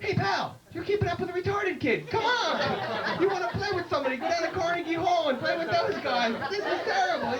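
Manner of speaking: high-pitched mock outrage; indignant scolding. Onlooker telling off the guitar player, indignant. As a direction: scold him in a high, worked-up voice.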